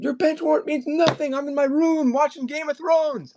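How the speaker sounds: high mock scream at mom; hammed-up screaming son. Man screams at mom who let cops in, mock scream. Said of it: "the way this guy was screaming".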